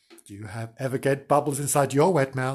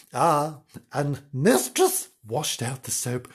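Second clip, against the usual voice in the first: ordinary delivery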